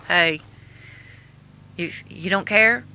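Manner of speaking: usual speaking voice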